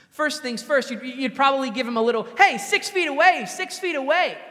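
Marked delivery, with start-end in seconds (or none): 2.4-4.4 much higher and louder, half-joking shout; mock warning shout. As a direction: bark it to push him back, playfully. Warning a stranger without mask.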